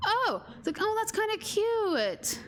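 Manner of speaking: high, slow cooing gush; gushing delight. Retold or imitated. Retelling her reaction to her sister, high gushing coo. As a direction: melt at the sweet gesture.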